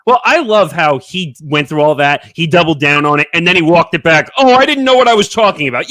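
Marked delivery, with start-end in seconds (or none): 4.4-5.9 mock Kyrie. Imitating Kyrie walking back.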